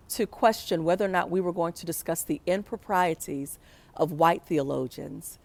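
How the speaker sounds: ordinary talk, nothing marked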